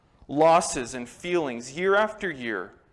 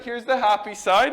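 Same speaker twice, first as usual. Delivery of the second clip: high, loud mock-cheery counselor; exaggerated, raised counselor voice; hammed-up counselor; 8 semitones higher, 7 dB louder than usual. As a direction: imitate a therapist presenting brightly, pitched up. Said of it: "I'm hamming it up a bit".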